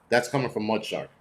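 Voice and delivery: ordinary delivery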